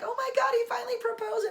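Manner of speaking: very high, fast, loud shriek of news; calling friends to shriek about the proposal; excited squeal. Retold or imitated. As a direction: shout it like breaking huge news. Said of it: "call people and be like".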